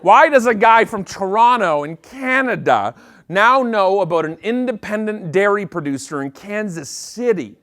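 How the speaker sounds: usual speaking voice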